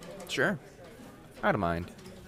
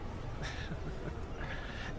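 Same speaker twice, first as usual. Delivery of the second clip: a quick soft giggle under the breath; soft amused giggle at the newcomer; 11 dB quieter than usual. Acted. Shy laugh after confusion.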